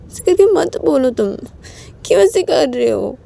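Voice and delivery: high, wailing apology; upset, high-pitched imploring; desperate pleading. Acted. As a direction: beg him to stop, pitch soaring then easing. Uma pleads and apologises in quarrel.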